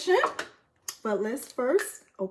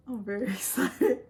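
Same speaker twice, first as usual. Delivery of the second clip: laughing excitedly. Real, not acted. Laughing while unboxing award.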